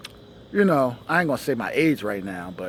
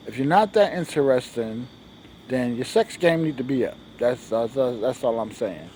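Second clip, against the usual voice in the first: usual speaking voice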